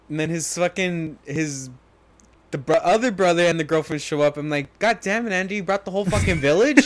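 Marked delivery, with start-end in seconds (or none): 4.8-6.9 higher-pitched mock exasperation; retold incredulity. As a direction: react in laughing disbelief at the crowd. Retold shocked reaction to uninvited guests.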